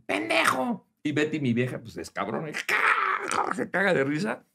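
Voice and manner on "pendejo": mock yell. Another driver insults him.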